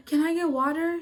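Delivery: high, loud, frantic plea for water; desperate plea. Retold or imitated. High student begs the teacher for water, frantic. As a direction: beg for water as if panicking.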